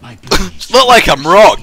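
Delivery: slow, put-on mocking echo. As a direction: parrot him sarcastically. Mocking repeat of friend.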